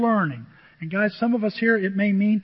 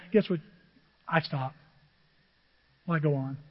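usual speaking voice

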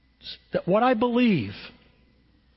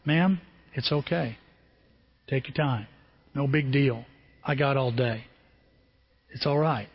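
calm the nervous cashier gently; reassuring; 5 semitones lower, 3 dB quieter than usual. Retold or imitated. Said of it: "and I go".

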